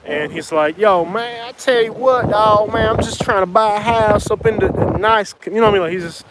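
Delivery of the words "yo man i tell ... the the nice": wannabe gangster Real G. Retold or imitated. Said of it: "this guy's like a total wannabe gangster".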